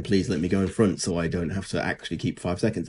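ordinary delivery

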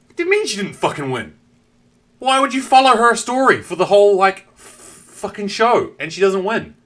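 high, loud, incredulous rant; outraged disbelief. Retold or imitated. Viewer who was rooting for her, outraged at the loss. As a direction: protest the result like a betrayed fan.

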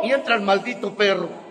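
ordinary delivery

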